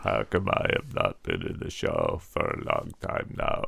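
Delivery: lightly voiced whining oddball; mock character voice. Puppet character asks.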